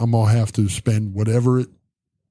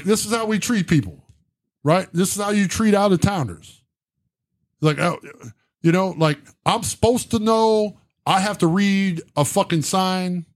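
higher-pitched, incredulous complaint; indignant protest; 6 semitones higher than usual. Retold or imitated. Out-of-towner protesting to the supervisor about the tow, indignant. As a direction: re-enact an aggrieved protest, pitched up. Retold argument over towed truck.

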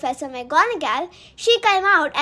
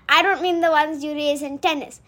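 ordinary delivery

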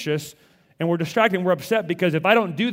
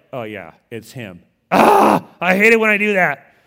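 sheepish Martha; 2 semitones higher, 8 dB louder than usual. Retold or imitated. Martha catching her own mistake, groaning then loud. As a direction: caught out, groan at yourself. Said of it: "she's like".

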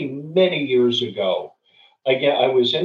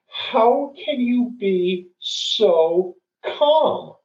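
high, very drawn-out imitated plea; near-tearful plea; 8 semitones higher, much slower than usual. College girl asking the calm traveller, imitated near-tearful plea. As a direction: stretch each word, as if close to tears. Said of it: "almost had a tear come out of her eye".

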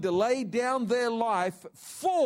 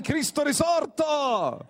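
slow, higher Italian-accented Cristo risorto; 8 semitones higher, 7 dB louder, slower than usual. Shown as a demonstration. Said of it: "I can say it in Italian".

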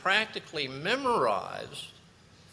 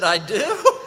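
asked through a real laugh, loud; a startled, amused question; laughing surprise; 9 semitones higher, 8 dB louder than usual. Real, not acted. Surprised at being imitated.

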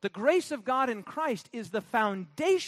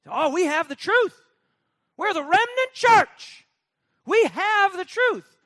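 much higher, louder, slowed mock of a self-satisfied believer; smug churchgoer; 10 semitones higher, 10 dB louder than usual. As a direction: parody the proud remnant church member. Mimicking smug remnant churchgoers.

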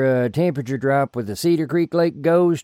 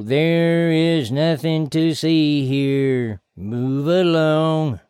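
slow, eerie, possessed voice; possessed monotone; slower than usual. Acted. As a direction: drone it like a hypnotized man. Possessed Bobby Ray again.

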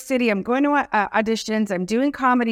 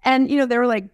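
normal talking voice

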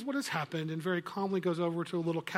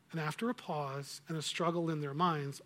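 ordinary talk

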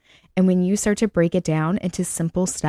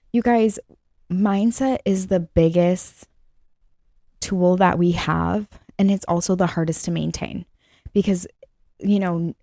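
normal talking voice

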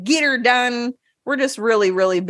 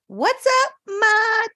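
silly high greeting; 12 semitones higher than usual. Pretend.

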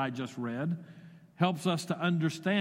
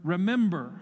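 "remember" louder and punched as the key word; preacher stressing the verse's key word "remember"; emphasis on remember; 4 dB louder than usual. Shown as a demonstration. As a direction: land hard on "remember". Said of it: "the word that gets the most emphasis is the word".

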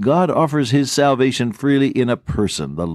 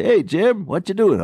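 bright, much higher friendly hello; cheerful call; 11 semitones higher than usual. Retold or imitated. Calling Jim to invite him for lemonade, much higher cheerful hello. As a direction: greet him on the phone like good news.

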